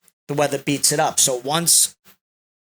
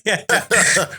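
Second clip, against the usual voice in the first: yeah through a laugh; 4 semitones higher than usual. Real, not acted. Laughing at a joke.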